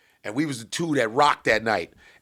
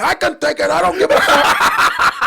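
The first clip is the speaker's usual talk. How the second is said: Scarface getting shot; 14 semitones higher, 8 dB louder than usual. Retold or imitated. Comic doing Scarface's death scene for a booing crowd, hammed-up and laughing. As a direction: play a shot gangster defiant to the end, laughing. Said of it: "start doing Scarface at the end".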